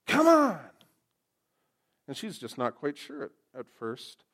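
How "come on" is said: loud, high acted yell; hammed-up exasperated call; impatient shout. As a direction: urge them along with a sudden shout. Acting out impatient rescuer calling out.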